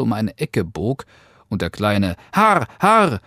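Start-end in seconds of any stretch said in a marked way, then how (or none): 2.3-3.2 high, loud horse-driving call; piercing little cry to the horse; Daumes Dick shouting. A narrator's reading. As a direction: shout it like a tiny carter.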